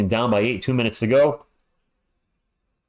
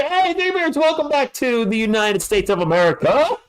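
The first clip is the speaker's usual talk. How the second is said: excited welcome; 10 semitones higher than usual. Acted. Welcoming a player back loudly.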